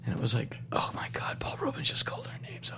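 hushed, breathless whisper; awed whisper. Retold or imitated. Telling his wife in an awed whisper. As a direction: whisper it like you can't believe it. Awestruck reaction, unvoiced.

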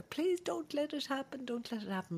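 put-on high, soft little-girl begging; childish pleading. Sisters begging their father, high soft childish plea.

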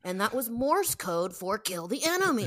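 high, loud, whiny nitpicker; mocking fan voice. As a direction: play an outraged know-it-all fan.

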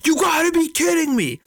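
high, loud outraged shout; shrieking at the bench in disbelief; angry fan yelling. Retold or imitated. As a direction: scream at the ref like a furious fan. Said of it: "they're yelling and yelling and screaming".

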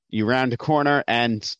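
ordinary delivery

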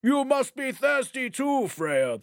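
courting giant, eager to please; a lightly voiced giant king; wooing giant king. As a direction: offer the drink sweetly. Thrym courts his bride.

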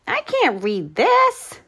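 high, slow shout; the cook shouting that he can't read the order; loud shout. Acted. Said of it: "shouted the cook".

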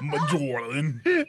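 Majorlin drawn out very slowly; tonguey. Shown as a demonstration. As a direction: stretch Majorlin, lingering on zh. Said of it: "Oh, that's tonguey".

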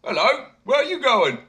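high, loud hail; calling out. A narrator's reading. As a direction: shout down from the ship. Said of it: "calling out".

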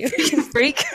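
giggling echo of the taunt; laughing. Real, not acted. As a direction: laugh through the insult.

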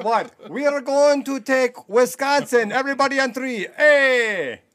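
high, loud hammed-up rallying shout; rally the troops in a mock invasion; mock battle cry. Friend playing invader rallies everyone, hammed-up shout.

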